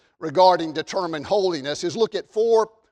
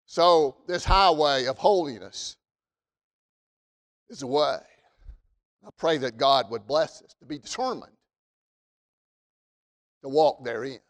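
much lower, hushed pulpit voice; prayerful; 5 semitones lower than usual. Real, not acted. Short prayerful close before teaching.

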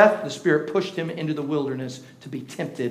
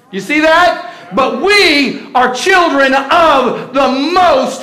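high, loud, slow preaching shout; rally the congregation with fire; full-force preaching; 8 semitones higher, 9 dB louder, slower than usual. Acted. Preacher rousing the church about the kingdom, fiery preaching.

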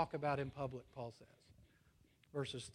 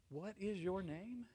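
deliberate questioning; 3 semitones higher, 7 dB quieter than usual. Retold or imitated. Alexander questions the timid soldier a second time, slowly and deliberately. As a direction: ask it slowly, each word set apart.

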